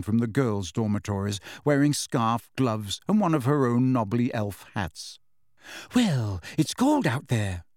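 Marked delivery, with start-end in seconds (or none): 5.9-7.6 slow, defensive protest. A narrator's reading. Hermione defends her hat to an impatient Ron, defensive. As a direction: justify it defensively, drawing the words out. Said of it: "she said defensively".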